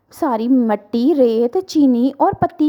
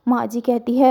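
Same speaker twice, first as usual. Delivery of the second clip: ordinary delivery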